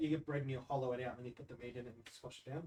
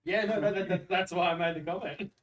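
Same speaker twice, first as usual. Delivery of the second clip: loud; 6 semitones higher, 12 dB louder than usual. Real, not acted. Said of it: "defends his joke loudly".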